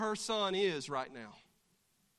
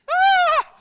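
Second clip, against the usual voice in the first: shocked scream; 19 dB louder than usual. Acted. Shocked reaction in skit.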